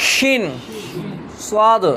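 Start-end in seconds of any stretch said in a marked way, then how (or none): 0.1-0.4 শিন said thick, with the tongue pressed against the palate, pitched higher; শিন with the tongue against the palate, full sh sound; thick shin. Shown as a demonstration. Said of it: "একটু মোটা করে জিভটাকে একটু টাকরার সঙ্গে চেপে দিয়ে বলেন".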